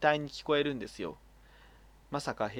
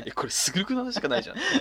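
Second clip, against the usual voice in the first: quick, higher, laughing mock reader; imitating a reader; 8 semitones higher, 5 dB louder, faster than usual. Imitating a listener reacting to the letter, laughing. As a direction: toss it off as a baffled listener, giggling. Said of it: "読んだ人がね".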